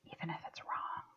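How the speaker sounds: barely audible whisper; whispered aside. Shown as a demonstration. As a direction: drop right down into a whisper. Confides a doubt in the round.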